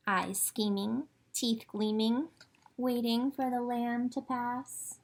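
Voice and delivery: normal talking voice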